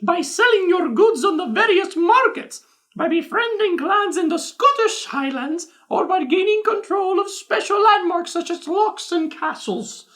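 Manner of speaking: high, posh-sounding mock English Queen voice reading the flavor text; the Queen of England reading a game box aloud; Queen of England impression. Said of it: "The Queen of England is reading this one".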